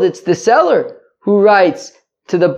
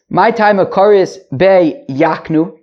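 ordinary talk

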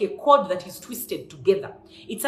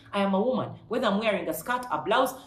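ordinary talk

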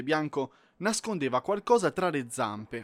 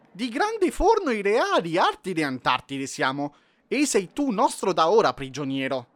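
pompous penguin royal; 5 semitones higher, 5 dB louder than usual. Acted. Penguin royals declare the elf their prisoner, pompous decree. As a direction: proclaim it grandly in a strange voice.

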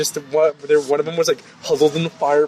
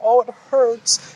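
mock whimper; 8 semitones higher, slower than usual. Injured woman whining.

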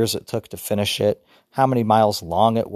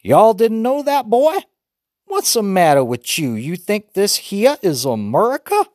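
much higher, louder mock Southern hillbilly accent; Dad keeps up the mock Southern hillbilly voice for more laughs; Southern-style talk; 10 semitones higher, 4 dB louder than usual. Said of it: "Dad to say some more Southern-style stuff".

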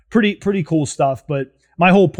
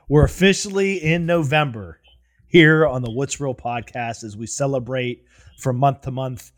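usual speaking voice

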